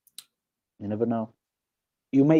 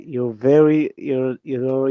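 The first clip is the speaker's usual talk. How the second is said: usual speaking voice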